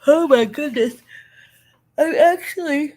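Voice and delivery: talking through a yawn; words stretched by a yawn. Real, not acted. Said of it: "The humidity is making me yawn".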